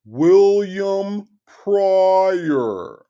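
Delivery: mock someone oddly dragging out their own name; William Pryor. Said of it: "it just sounds like something is wrong".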